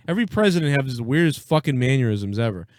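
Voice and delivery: normal talking voice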